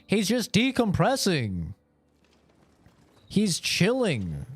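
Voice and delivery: high, loud, fed-up comeback; mock exasperated retort. Answering the worried wives online, in a high loud exasperated mock. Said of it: "It's like".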